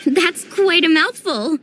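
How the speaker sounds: usual speaking voice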